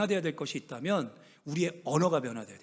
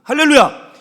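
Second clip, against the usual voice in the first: loud, higher call of hallelujah; hallelujah shout; 8 semitones higher, 14 dB louder than usual. Real, not acted. Pastor rousing the congregation, a loud higher shout. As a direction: rouse the congregation.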